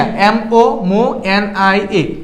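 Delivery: very slow, letter by letter; slow spelled letters. Shown as a demonstration. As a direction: spell it out slowly for students. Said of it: "এরকম ভেঙে ভেঙে পড়বা". (খুব ধীরে বানান করে)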